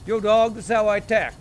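loud, high put-on gangster talk; lightly hammed-up street tough. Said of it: "that was my gangster voice".

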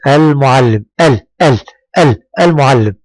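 المعلم with the L of ال sounded clearly; pronounced lam. Shown as a demonstration. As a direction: isolate ال, then say المعلم with its L. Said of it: "نعم، أنطقها". (إظهار اللام في المعلم)

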